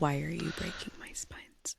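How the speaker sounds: pained stage whisper; whispered plea. Pretend. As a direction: whisper it like a wounded book lover. Mock plea to book abusers.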